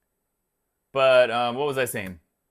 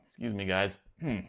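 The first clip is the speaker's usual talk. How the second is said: burp; 6 semitones lower, 8 dB quieter than usual. Real, not acted. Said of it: "My dinner making its way back up".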